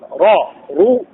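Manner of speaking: را، رو with thick, heavy R. Shown as a demonstration. Teacher drills the vowels for students, heavy R in را، رو. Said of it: "تفخيم".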